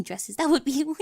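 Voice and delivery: breaks into a high giggle; flustered giggling; nervous laugh. Acted. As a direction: laugh awkwardly through it. Nervous laugh mid-sentence.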